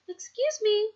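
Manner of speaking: put-on high, bright and friendly old lady; bright old woman. A narrator's reading. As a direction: greet them sweetly as a kind grandma. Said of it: "she said brightly".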